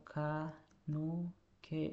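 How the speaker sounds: kanoke pronounced very slowly, syllable by syllable; pelafalan lambat. Shown as a demonstration. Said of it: "Ini bacanya kanoke". (ucapkan kanoke perlahan, suku demi suku kata)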